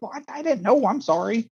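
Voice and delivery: high, small self-mocking voice; sheepish apology. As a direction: make yourself sound tiny and caught out.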